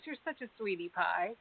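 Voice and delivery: normal talking voice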